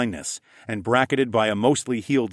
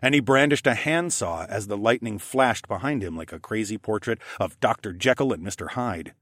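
usual speaking voice

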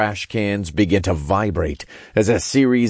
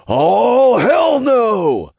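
loud, high horrified scream; high-pitched shouted refusal; 6 dB louder, much slower than usual. A narrator's reading. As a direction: scream it out, stretching the oh. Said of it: "Todd screamed".

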